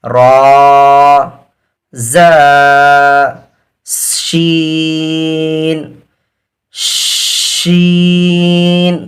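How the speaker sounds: each letter name called out and held; teacher's chanting drill; alphabet recitation. Shown as a demonstration. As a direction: recite the letters slowly for the class to repeat.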